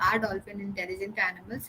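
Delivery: rushed question. Shown as a demonstration. Teacher showing a careless reading to the class, fast and flat. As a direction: hurry through Are dolphins intelligent animals without expression. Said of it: "I read it like this".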